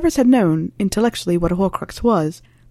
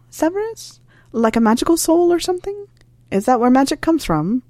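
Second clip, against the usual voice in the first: high, incredulous questioning; disbelieving rising voice; surprised; 8 semitones higher than usual. Acted. Harry incredulous about souls.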